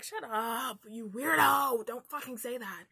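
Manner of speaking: loud, drawn-out playful telling-off; exasperated, rising to a loud peak; mock outraged scolding. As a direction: tell off the writer in hammed-up disgust. Acted telling-off of the author.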